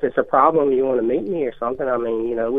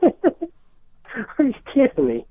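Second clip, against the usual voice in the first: laughing; 11 semitones higher, much slower than usual. Real, not acted. Prank call target laughing in disbelief.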